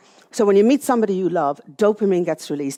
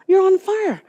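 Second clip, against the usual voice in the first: much higher, excited mother's voice; thrilled mother; 12 semitones higher, 5 dB louder, slower than usual. Retold or imitated. Mother on the phone to Nora, much higher and thrilled. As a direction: squeal it like a delighted elderly mum.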